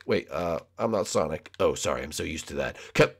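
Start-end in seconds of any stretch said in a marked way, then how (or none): none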